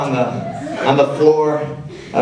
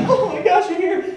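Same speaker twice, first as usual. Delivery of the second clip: much higher, relieved little cry; relieved squeal. Retold or imitated. Rescued from the bathroom, greeting mom in a much higher relieved squeal. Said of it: "I'm like".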